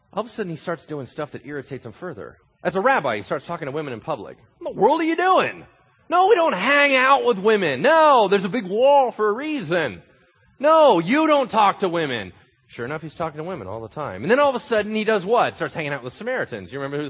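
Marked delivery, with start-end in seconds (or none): 4.6-12.3 loud, high scolding; scandalised leaders. Acted. Leaders scolding Jesus about women: loud, shocked. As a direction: scold him for breaking the rule.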